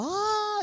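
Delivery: sudden very high shriek; mock woman's shriek from the story; retold shriek. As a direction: let out a short piercing cry. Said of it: "Y ella gritaba".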